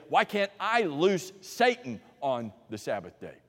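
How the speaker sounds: ordinary delivery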